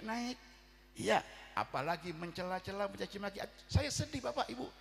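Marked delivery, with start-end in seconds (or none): none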